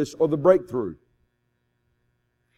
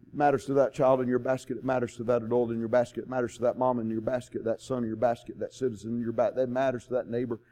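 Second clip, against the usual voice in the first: softer, low, intimate closing; soft earnest appeal; 5 semitones lower, 8 dB quieter than usual. Acted. Preacher closing to congregation, softly and earnestly. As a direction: lay it down gently.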